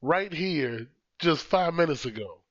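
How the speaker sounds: high, disbelieving outburst at furniture; high-pitched mock outrage; indignant at the couch. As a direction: accuse the couch of moving. Arguing with the couch.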